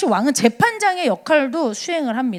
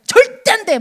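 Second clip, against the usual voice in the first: mock strict refusal; 8 semitones higher, 5 dB louder than usual. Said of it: "내가 싫어하는 사람은".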